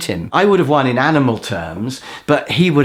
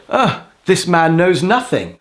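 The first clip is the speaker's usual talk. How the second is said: scornful jibe; 5 semitones higher, slower than usual. Retold or imitated. Acting out the games player's scornful jibe.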